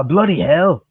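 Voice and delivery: put-on British exclamation; most British accent. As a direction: curse in an exaggerated British accent. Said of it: "do the most British accent".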